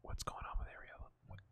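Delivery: very soft, whispered question to oneself; hushed muttering. Real, not acted. Gamer wondering about his injured player, hushed muttering. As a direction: drop to a near whisper, puzzled.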